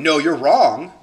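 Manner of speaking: loud, acted defensive denial. Rejecting the criticism instead of acknowledging it, defensive. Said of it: "getting defensive going".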